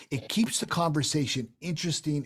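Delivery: normal talking voice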